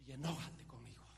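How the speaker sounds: preacher building to a roar; fiery preaching. Acted. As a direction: preach it with rising fire. Preaching a sermon. (predicación ardiente)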